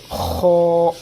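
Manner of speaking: long rasping خو through rounded, barely opened lips; mouth nearly closed. Shown as a demonstration. Teacher shows lips making a little room, full rasping خو. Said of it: "منہ بند کر کے پڑھیں گے".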